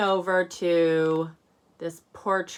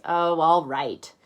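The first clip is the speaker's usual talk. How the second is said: grudging; 6 dB louder, slower than usual. Acted. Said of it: "He says".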